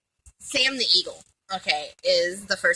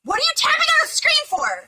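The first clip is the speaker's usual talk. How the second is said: exasperated; 6 dB louder than usual. Real, not acted. Annoyed at friend filming.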